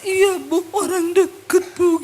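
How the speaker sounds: high, drawn-out sobbing; imitated crying. Woman telling pastor who hurt her, imitated sobbing. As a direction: cry it out in mock wails. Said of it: "Dia nangis".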